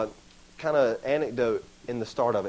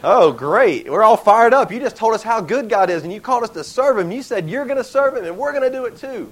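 loud, higher-pitched exasperated protest; mock indignation; 8 semitones higher, 9 dB louder than usual. Israelites complaining to Joshua who called them to serve, mock indignation. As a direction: argue back like a let-down, fired-up crowd.

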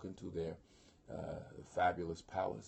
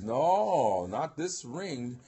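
loud, high, drawn-out protest; hammed-up horrified refusal; emphatic refusal; 5 semitones higher, 8 dB louder, much slower than usual. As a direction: cry out a theatrical no, slowly. Voicing Wotan's refusal.

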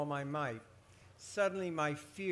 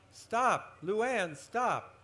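high, loud cries to stop; acted scream, sharply raised pitch; screamed command; 6 semitones higher, 5 dB louder, much slower than usual. A narrator's reading. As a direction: shout a frantic halt. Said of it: "I heard Missus Martin screaming".

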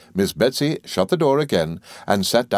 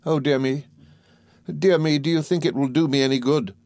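high, mildly helpless plaint; a touch of a helpless mother; helpless cry; 8 semitones higher than usual. A narrator's reading. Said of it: "cried my mother in a helpless manner".

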